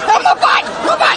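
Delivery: pained cry. Acted. Sunburnt back, crying in pain.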